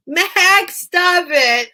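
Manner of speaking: high loud mock scold; playful scolding. Real, not acted. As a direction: tease him loudly. Fond telling-off to viewer.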